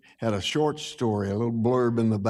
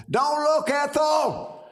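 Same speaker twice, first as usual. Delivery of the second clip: much higher, shouted warning to Ethel; hollering; slower than usual. Retold or imitated. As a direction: yell it over to warn her. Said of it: "I hollered over".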